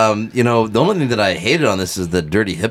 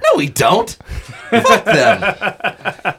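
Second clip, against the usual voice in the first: laughing; 10 semitones higher, much slower than usual. Real, not acted. Joking defiance with laughter.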